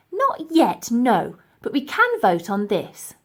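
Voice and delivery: loud defiant retort; Elsie retorting. Acted. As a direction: fight back.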